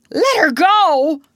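loud, high, drawn-out cry of disbelief; outraged cry. A narrator's reading. Hook hears his captive was freed, crying out. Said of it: "cried Hook".

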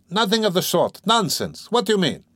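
high, flustered protest; indignant denial. Acted. Razumihin denying he blushed, indignant and flustered. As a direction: reject the teasing in short bursts.

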